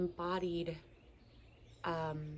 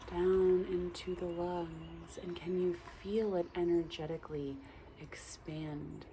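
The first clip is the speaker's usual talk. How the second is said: guided meditation; 4 dB quieter than usual. Acted. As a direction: gently guide the listener's breath. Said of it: "a little guided meditation for you".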